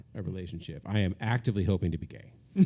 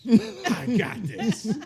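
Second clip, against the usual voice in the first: very slow, higher, laughing boast; proud laughing; 12 semitones higher, 4 dB louder, much slower than usual. Retold or imitated. Proud man boasts after naming the board, very slow and laughing. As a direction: stretch it out like a proud winner.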